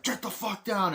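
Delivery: high, urgent mock yell; acted soldier's order. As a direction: shout them down like a film soldier.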